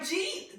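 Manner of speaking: "Jeet" as one rising syllable, high and laughing; Queens slurred "Jeet". Retold or imitated. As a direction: repeat "Jeet" for did you eat, amused. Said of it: "the way we say it at home in Queens".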